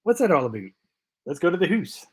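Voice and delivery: teasing, exaggerated Canadian accent; hammed-up Canadian caricature; mock Canadian accent. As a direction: put on a jokey Canadian voice, vowels rounded into "hoose". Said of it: "Hey, I'm Canadian".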